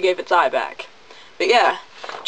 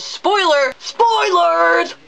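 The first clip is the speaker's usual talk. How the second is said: mock alarm call; 9 semitones higher, much slower than usual. Announcing spoiler warning.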